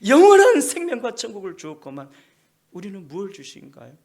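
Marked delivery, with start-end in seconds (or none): 0.1-2.1 loud, high preaching voice; full-force sermon delivery; forceful preaching. Acted. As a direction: preach it out to the congregation. Preaching peak.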